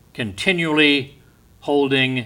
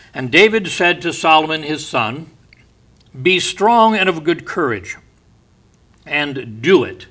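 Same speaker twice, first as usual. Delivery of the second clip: preaching; 3 semitones higher, 5 dB louder than usual. Acted. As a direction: proclaim the verse with power. Preaching David's charge.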